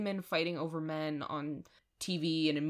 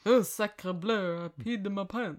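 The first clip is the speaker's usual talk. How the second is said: bad French accent; 2 semitones higher than usual. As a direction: overdo a French accent, badly. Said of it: "So [name]'s accent, ... it's horrible".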